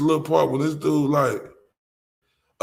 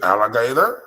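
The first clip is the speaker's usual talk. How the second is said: imitating toddler; 6 dB louder than usual.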